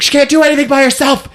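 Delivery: high, fast mock rant; hammed-up exasperated complaint; exasperated outburst. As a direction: rattle it off high-pitched in exasperation. Retold outburst by Kirstie Alley.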